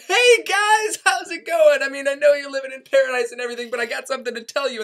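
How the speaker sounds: high, fast, overly friendly greeting; breezy high-pitched hello, rushing on; chipper Raphael. Retold or imitated. Raphael greeting Adam and Eve, high and chipper. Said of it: "And he's like".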